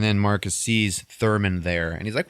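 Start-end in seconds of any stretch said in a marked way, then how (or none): none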